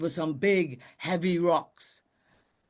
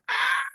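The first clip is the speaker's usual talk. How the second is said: eagle screech. Acted. Eagle cry.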